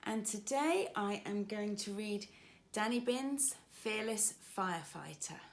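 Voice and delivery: plain reading voice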